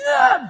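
high loud scream; a sudden frightened shriek; panicked scream. Retold or imitated. Family scattering from charging dog.

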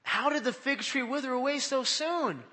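high-pitched astonished question; marveling. Acted. Disciples marveling at the withered fig tree, astonished. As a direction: read it as amazed disciples. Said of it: "they marveled".

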